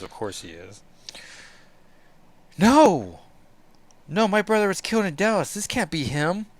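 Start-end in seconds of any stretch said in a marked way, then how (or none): 2.6-6.5 put-on higher, fast, horrified girl; high, frantic denial of a young heroine; Illyana's voice, shocked denial. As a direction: refuse to believe it, rushing.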